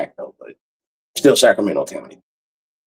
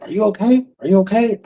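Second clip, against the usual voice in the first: higher-pitched concerned check-in; worried coworker; 8 semitones higher than usual. Retold or imitated. Coworker checking on him after police stop, high concern. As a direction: lightly imitate a coworker's anxious asking.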